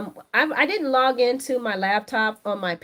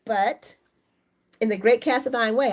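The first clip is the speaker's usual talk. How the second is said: ordinary talk, nothing marked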